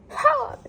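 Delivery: high, loud "Ha!" of alarm; mock gasp. Driver retelling the engine failing, high mock gasp. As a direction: cry out high in staged shock.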